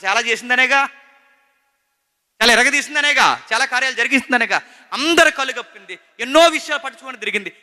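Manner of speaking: high, loud preaching to the church; fervent sermon voice; full-force preaching. Acted. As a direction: drive each claim home from the pulpit. Heated preaching run.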